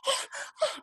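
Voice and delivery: high-pitched gasping; short, high gasps of panic; frantic gasps. Retold or imitated. Rabbi jumping up and down, high frantic gasps. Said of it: "he's jumping up and down and he's like".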